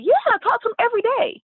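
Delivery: high, quick imitation of an enthusiastic answer; quick high-pitched quote; eager reply.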